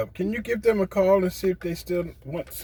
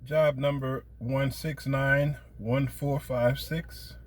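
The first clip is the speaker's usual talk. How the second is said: slow; 13 dB quieter, much slower than usual. Real, not acted. Caller reads out job number.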